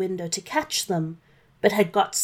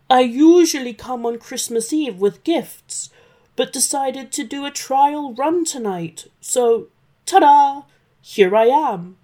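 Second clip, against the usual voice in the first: nervous Santa; 4 semitones higher, 6 dB louder than usual. Acted. Santa improvising to dragon.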